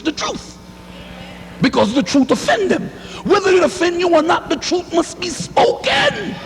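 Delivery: preaching at full force. Acted. Preacher shouting at offended listeners, full force. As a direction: shout the truth at the congregation.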